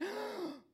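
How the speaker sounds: high, sudden intake of breath; sharp gasp. Retold or imitated. Spirit takes her breath away in church, sharp gasp. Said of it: "just like that".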